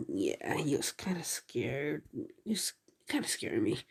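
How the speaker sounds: unvoiced secretive whisper; whispered aside. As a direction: whisper it like a nervous confession. Deku confides he was scared.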